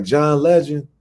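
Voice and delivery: loud high call of the name; naming him in front of everyone. Retold or imitated. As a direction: announce it over the room. Calling out new name.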